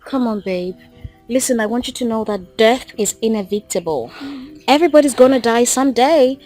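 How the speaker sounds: low-pitched, steady reassurance; comforting and measured; consoling. Acted. Consoles grieving partner about death.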